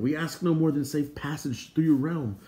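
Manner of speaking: high, earnest appeal; earnest petitioner. Acted. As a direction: plead a polite case before a king. Respectful petition to the king.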